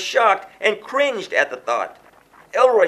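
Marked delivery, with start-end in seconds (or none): 2.5-2.9 alarmed gasp of his name, higher and louder; startled, higher cry of a name. A narrator's reading. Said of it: "she gasped".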